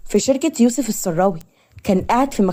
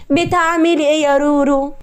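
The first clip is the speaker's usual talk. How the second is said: higher-pitched teasing call; playful greeting; 6 semitones higher than usual. A narrator's reading. Friend coming into the kitchen to Rewan, playful.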